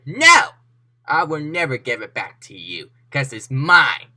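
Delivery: loud defiant no; shouted refusal. Acted. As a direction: refuse loudly like a brat villain. Villain refuses to return painting.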